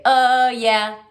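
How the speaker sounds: high, loud, happy cheer. Shown as a demonstration. Teacher to class, modelling an excited cheer. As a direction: shout it out for your teacher.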